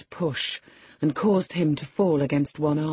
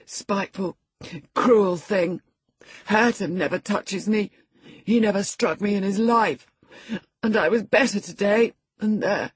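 put-on higher, aggrieved boy dying into whimper; whimpering complaint; 5 semitones higher, 3 dB louder than usual. A narrator's reading. Sick boy blaming his cousin after his fit, whimpering. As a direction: whine the grievance, breaking off whimpering. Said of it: "His voice died in a whimper".

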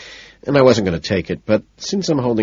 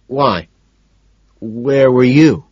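slow, louder, turning it back; evasive; 4 semitones higher, 5 dB louder, much slower than usual. A narrator's reading. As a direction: answer a question with a question. Patrick deflecting, turning question back.

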